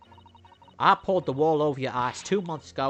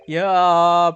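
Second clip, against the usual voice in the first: embarrassed yeah; 2 semitones higher, 10 dB louder than usual. Acted. Inspector embarrassed by remark.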